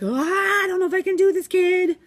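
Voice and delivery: a teacher overwhelmed by a hard student; exasperated worry. Retold or imitated. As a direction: throw up your hands in dramatic doubt. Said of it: "was like".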